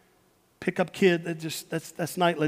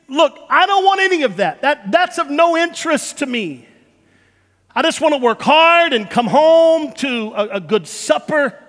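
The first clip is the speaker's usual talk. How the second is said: higher, louder brush-off; dismissive parent; 7 semitones higher, 7 dB louder than usual. Acted. Tired parent refusing to talk feelings, loud impatient brush-off. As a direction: wave the feelings away impatiently.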